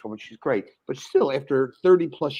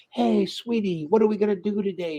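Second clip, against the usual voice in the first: chirpy greeting; 6 semitones higher than usual. Retold or imitated. Cheerfully greeting wife at breakfast.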